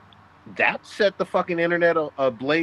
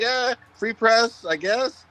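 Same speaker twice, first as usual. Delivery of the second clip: shrugging indifference; 9 semitones higher, slower than usual. Retold or imitated. Blizzard shrugging off the porn.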